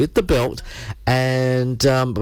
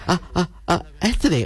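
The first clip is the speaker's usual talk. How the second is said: surprised guests; 6 semitones higher, faster than usual. Retold or imitated. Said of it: "they're going".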